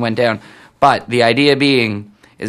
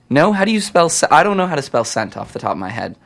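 normal talking voice

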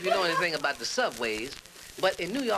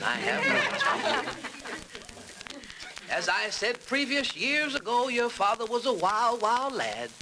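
high, drawn-out mock confession; father breaking news; 7 semitones higher, slower than usual. Father confessing to his son, mock announcement. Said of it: "He says, "Son".

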